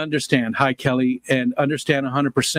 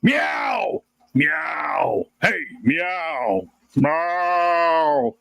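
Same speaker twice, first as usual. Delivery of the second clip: yowling cat; 5 semitones higher, much slower than usual. Retold or imitated. Owner imitating his cat waking them at two a.m., yowling meows. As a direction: wail like a cat that wants attention. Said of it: "It was like".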